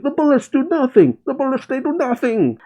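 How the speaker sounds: high, strained mock-panic cry; panicked soldiers. Soldiers shooting uselessly at a monster, high panicked cry. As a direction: wail it up high, hammed-up. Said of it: "soldiers shoot at it saying".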